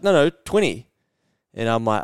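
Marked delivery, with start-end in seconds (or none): 0.0-0.9 higher, louder mock trainer voice; insistent trainer. Personal trainer insists he does twenty reps, higher and louder. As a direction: correct him firmly like a coach. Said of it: "He's like".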